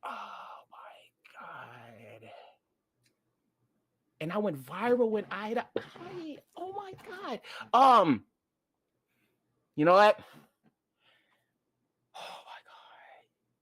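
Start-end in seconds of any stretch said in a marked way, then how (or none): 0.0-2.3 breathless whispered oh my God. Real, not acted. Host agonizes over picking a song, whispering. Overwhelmed by hard choice. 12.2-13.6 breathless whispered oh my God. Real, not acted. Host agonizes over picking a song, whispering. Overwhelmed by hard choice.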